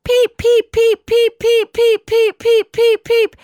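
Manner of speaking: very high, loud, squeaky peep peep peep; frantic gosling peeps. Retold or imitated. Telling friends about the lost gosling, high frantic peeping. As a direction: mimic a scared baby goose screaming for its mom. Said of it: "Scared, frantic peeping, screaming".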